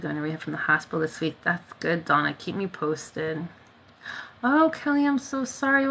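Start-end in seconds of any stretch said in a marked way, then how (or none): 4.4-5.9 high-pitched rush of sympathy; sympathetic. Real, not acted. Consoling a viewer with bad news, sympathetic. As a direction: reach out to them in a raised, tender voice.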